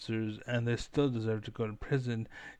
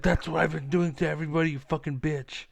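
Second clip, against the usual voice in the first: fast, higher, louder furious rant; angry outburst; 4 semitones higher, 5 dB louder than usual. Real, not acted. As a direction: let it explode at the rival. Ranting at a gaming rival.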